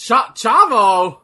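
loud surprised call of a name; excited shout. Real, not acted. Recognizing wrestler.

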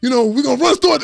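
rally the team like a coach; imitating a coach's hyped speech; fired-up coach. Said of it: "he said".

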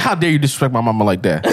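low-pitched mock indignation; deep, joking outrage; mock offence. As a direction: play offended on mama's behalf, voice dropped. Mock offended reply to the insult.

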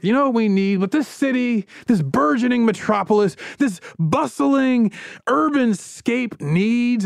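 hammed-up huckster teasing the big idea; showman pitch. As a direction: sell it like a carnival barker. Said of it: "He's like".

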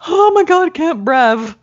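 high, loud breathless mock gasp; imitating Millie. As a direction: squeal it like you can't breathe from laughing. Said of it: "she'll go".

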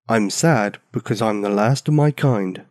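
ordinary delivery, nothing marked

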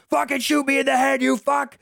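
high-pitched imitation of a raging gangster; mock furious dare. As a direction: goad the gunman in a high, strained mock yell. Acting gangster daring man with gun.

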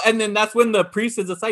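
usual speaking voice

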